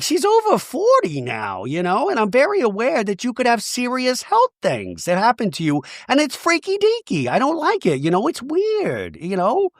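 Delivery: hammed-up worried friend; mock concern, pitched well up; Gina impression. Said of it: "And she's like".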